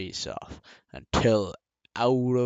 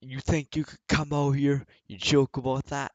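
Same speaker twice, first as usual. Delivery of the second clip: imitating an angry yell, higher and louder; mock-furious callout, pitched up and loud; retold rage, voice raised high; pissed off; 6 semitones higher, 5 dB louder than usual. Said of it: "he's so pissed".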